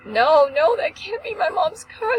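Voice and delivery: high-pitched panicked wail; retold screaming. Daughter seeing her mom's wrecked car, screaming denial. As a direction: cry it out in re-enacted disbelief. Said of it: "now I'm screaming".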